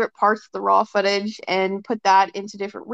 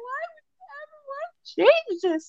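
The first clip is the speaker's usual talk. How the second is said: much higher, teary mock-sobbing husband; wife imitating her husband sobbing over the wedding video. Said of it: "he's crying, like, sobbing".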